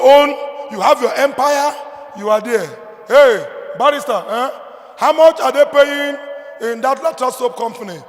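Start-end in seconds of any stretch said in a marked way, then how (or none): 3.1-8.0 father loudly quizzing his barrister son about pay; proud father calling out to son. Retold or imitated. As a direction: hail the son like a proud papa showing off. Father voicing himself to barrister son.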